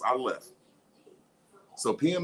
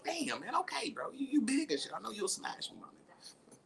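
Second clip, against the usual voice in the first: meek mouse; 9 semitones higher, 8 dB quieter than usual. Pretend. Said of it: "I'm like".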